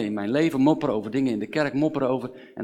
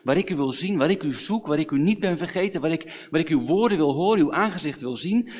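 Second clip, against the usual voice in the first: raised, insistent pleading; fervent lament, pitched higher; impassioned complaint; 4 semitones higher, 5 dB louder than usual. Acted. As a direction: pile up each clause urgently before God. Voices David's complaint to God.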